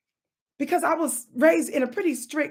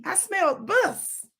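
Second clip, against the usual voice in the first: slow teasing; 2 semitones higher, much slower than usual. Real, not acted. Teasing joke.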